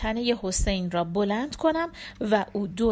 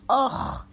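pained cry; 4 semitones higher, slower than usual. Acted. Wounded man cries as knife pulled.

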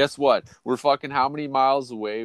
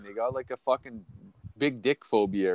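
ordinary delivery

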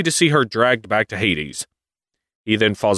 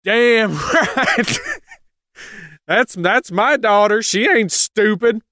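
much higher, laughing proud mom voice; proud Demeter; 11 semitones higher, slower than usual. Retold or imitated. Demeter boasting about her daughter in a high laughing voice. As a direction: brag about her girl. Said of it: "Demeter's like".

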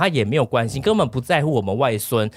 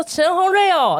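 grandma voice, pitched much higher; imitated grandma; 4 dB louder, slower than usual. Grandson retells grandma's phone call, higher imitated grandma voice. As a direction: play grandma calling out your name on the phone, higher.

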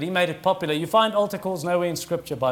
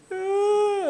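high, held mock whimper; mock crying wail; 13 semitones higher than usual. Preacher showing how he set his toddler off crying, mock wail. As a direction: put on a drawn-out, high baby cry. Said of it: "she really starts crying".

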